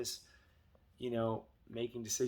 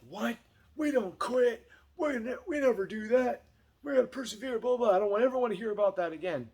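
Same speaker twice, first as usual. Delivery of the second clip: mock outraged parent, much higher and louder; pushy parent; 10 semitones higher, 7 dB louder than usual. Pushy parent lecturing kid who wants to quit, mock outraged. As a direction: ham up a lecturing parent who won't let them quit. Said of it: "So don't give it any energy".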